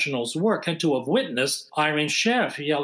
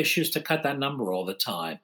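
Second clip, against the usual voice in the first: ordinary talk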